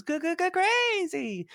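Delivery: stammer, then jump high on crazy; mock stutter to crazy.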